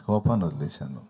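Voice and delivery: low, gentle and over-serene; hammed-up calm of a reformed man; lightly put-on serenity; mock saintly patience. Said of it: "దీర్ఘశాంతంతో".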